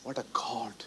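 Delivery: a hushed aside to the congregation; awed whisper. Real, not acted. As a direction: whisper it in wonder. Awed aside after sermon point.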